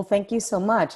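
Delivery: ordinary talk, nothing marked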